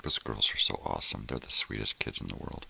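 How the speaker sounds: ordinary delivery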